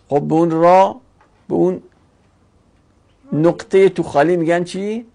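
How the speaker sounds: normal talking voice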